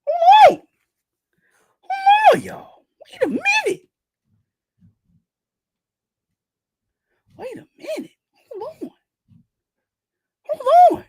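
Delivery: stunned outburst. Real, not acted. Streamer to viewers, losing it over the chapter. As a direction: cry out in disbelief.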